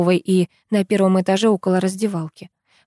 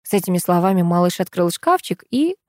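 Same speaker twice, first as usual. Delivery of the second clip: plain reading voice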